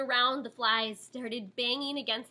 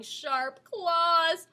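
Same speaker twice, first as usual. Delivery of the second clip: ordinary delivery, nothing marked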